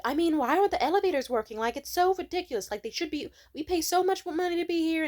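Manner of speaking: loud, high, quick irate rant; yelled complaint. Retold or imitated. Man ranting at the worker about broken elevators, yelling. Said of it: "he started yelling".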